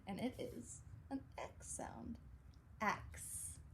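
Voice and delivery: "Axe" whispered with ks ending; whispered x sound. Shown as a demonstration. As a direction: breathe out "Axe" softly. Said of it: "that x sound".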